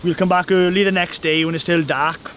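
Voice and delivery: usual speaking voice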